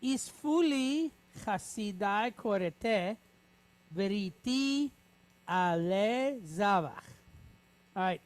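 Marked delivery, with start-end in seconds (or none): 0.0-7.0 slow, even recitation of the sentence; slow read-aloud. Shown as a demonstration. Teacher repeating the Hebrew sentence slowly for the class. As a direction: read it through once more, slowly. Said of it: "Let's do it one more time".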